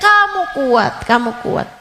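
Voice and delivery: ordinary talk, nothing marked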